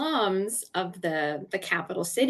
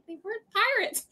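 high, fast protest; mock protest; 14 semitones higher, 3 dB quieter, much faster than usual. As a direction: squeak out a quick mock correction. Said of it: "I'm like".

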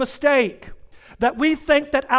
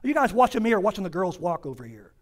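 quick, lower, dropped-volume joke; teasing aside; 6 semitones lower, 5 dB quieter, faster than usual. Real, not acted. Pastor teasing listeners distracted by passersby, quick aside. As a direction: toss it off to the crowd fast.

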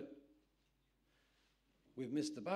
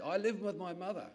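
louder repeat; 7 semitones higher, 8 dB louder than usual. Retold or imitated. Repeating after "Beg pardon?.

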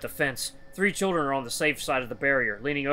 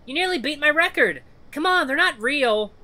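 put-on high, loud child's taunt; jeering children; 11 semitones higher, 5 dB louder than usual. Children goading boy behind the fence, merciless jeering. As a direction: jeer him on in a kid's voice. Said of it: "The other children jeer him on mercilessly".

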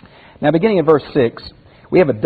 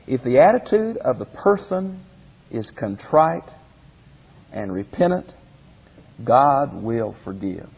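ordinary talk

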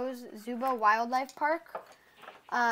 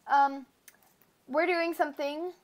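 usual speaking voice

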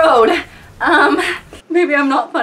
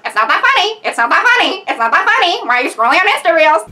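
mocking, higher parrot voice; parrot mimicry; 4 semitones higher, faster than usual. Mocking the boyfriend like a parrot squawking in her ear, higher and fast at the end. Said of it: "you're a parrot squawking".

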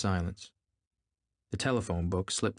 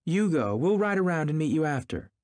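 complaining; 8 semitones higher, 4 dB louder than usual. A narrator's reading. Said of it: "she complained".

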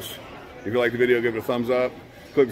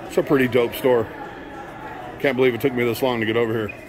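normal talking voice

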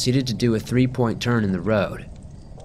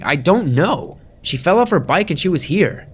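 frantic protest; 7 semitones higher, 4 dB louder than usual. Acted. Narrator protests to deputy.

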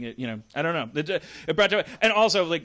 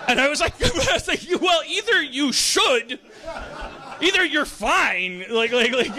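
laughing; 10 semitones higher, 6 dB louder than usual. Real, not acted. Cracking up retelling his reaction.

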